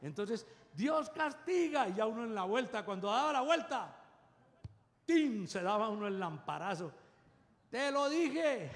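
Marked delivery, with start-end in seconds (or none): 0.7-1.9 mother's warning. Retold or imitated. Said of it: "gritaba así". 7.7-8.7 mother's told you so. Retold or imitated. Mother's I-told-you-so after fall.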